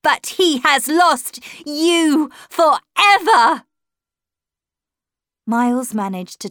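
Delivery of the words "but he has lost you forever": high, loud, drawn-out shriek; triumphant shriek. A narrator's reading. Governess shrieking at the horror outside the window, triumphant. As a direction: shriek it slowly, hammering out each word. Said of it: "I shrieked towards the horror".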